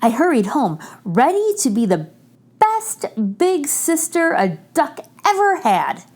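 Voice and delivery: ordinary delivery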